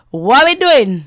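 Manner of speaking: loud sing-song try at the phrase, "doing" swooping up and down; attempting the Caribbean sing-song lilt; attempted sing-song accent. Shown as a demonstration. Radio host attempting the track's sing-song delivery, loud sing-song. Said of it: "I didn't say it right".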